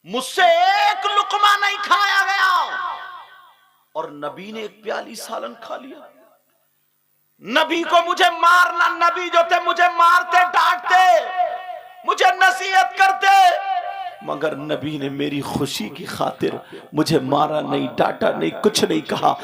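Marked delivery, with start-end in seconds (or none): 0.1-2.5 anguished cry. Acted. Preacher voices weeping Hafsa. 7.4-19.4 loud, impassioned sermon cry; preaching at full force. Acted. Preacher to the congregation, loud and impassioned.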